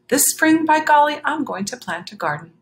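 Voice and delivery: high, loud, determined vow; eager gardener. A narrator's reading. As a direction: announce the plan with gusto. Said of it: "said Mister McGreely".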